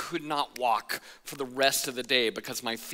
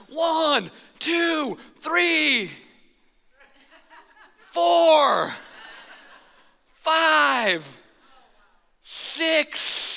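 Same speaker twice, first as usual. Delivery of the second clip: high, loud slow count-up; shouted count; 11 semitones higher, 5 dB louder, much slower than usual. Retold or imitated. Friends at the bottom shouting the count, high and loud. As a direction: shout each number, growing worried.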